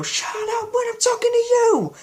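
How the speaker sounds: very high shrieked lyric. Retold or imitated. Fan showing how the singer shrieks and screams, shrieking. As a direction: imitate a singer's scream, pitch leaping right up. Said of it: "he has moments where he shrieks and screams".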